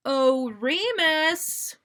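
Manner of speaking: very slow, loud sing-song call of Remus; drawn-out call. Acted. Sirius calling to his friend Remus, drawn-out. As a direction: stretch every vowel as you call him. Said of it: "drawing out the vowels".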